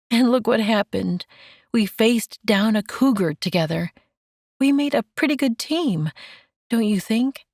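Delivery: a hint of a proud young woman; pleased and teasing.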